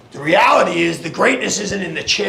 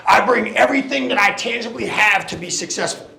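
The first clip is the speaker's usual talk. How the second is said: usual speaking voice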